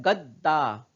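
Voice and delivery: गद्दा with the first द halved, held 'gad-daa'; half consonant. Shown as a demonstration. Teacher showing pupils the halved letter, गद्दा as 'gad-daa'. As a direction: close on a half द, then release दा. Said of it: "द आधा हो जाता है".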